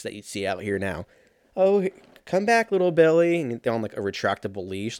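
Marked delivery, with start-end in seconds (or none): 1.5-3.4 high, sing-out mock parent voice; mock parent calling. Parent calling back a leashed child, mocking high call.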